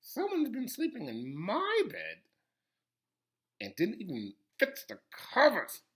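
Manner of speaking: lightly voiced, swooping Mama Bear; a hint of a miffed middle-sized bear. A narrator's reading. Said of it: "in her middle-sized voice".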